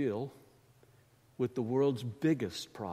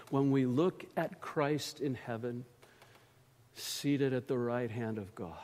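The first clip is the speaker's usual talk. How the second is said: ordinary talk, nothing marked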